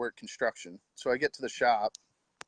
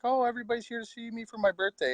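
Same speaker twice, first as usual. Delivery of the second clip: put-on much higher, quicker excited child voice; imitating young son; 11 semitones higher, faster than usual. Son thinks everyone came for his birthday, imitated high excited child. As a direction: mimic a delighted boy, high and rushed. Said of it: "B thinks that all these people are here to see him".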